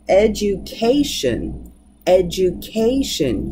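education said slowly with the stress on CA; slow stress demo. Shown as a demonstration. Teacher slowly modelling education with the stress on CA. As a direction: stretch out education, leaning on CA. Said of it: "You can hear it right there, the penultimate".

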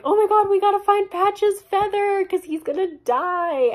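high, loud mock panic; hammed-up frantic squeal; Nora. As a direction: cry it out in exaggerated alarm. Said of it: "So now Nora is like".

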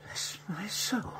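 slow whispered astonishment; awed whisper. Acted. Doctor stares at the heaving parcel, whispering in awe. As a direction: breathe it out in disbelief.

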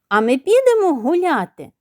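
put-on high-pitched child's question; young chick. A narrator's reading. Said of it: "запитало батька молодше неслухняне курча".

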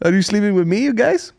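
high-pitched Brazilian broken English; imitated Brazilian accent. Brazilian comic chatting to Irishmen, imitated high Brazilian accent. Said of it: "She said it in very broken English".

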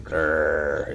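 drawn-out imitated "grrr"; animal growl. Shown as a demonstration. Teacher spells the animal noise, imitated growl. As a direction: growl out a long R. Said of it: "동물들이 으르렁 할 때".

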